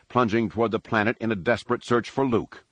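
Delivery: usual speaking voice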